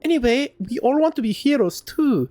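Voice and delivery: put-on high-pitched, accented kid, thrilled to meet a hero; high, excited child character; eager young adventurer. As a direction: gush like an admiring youngster. Same youngster voice.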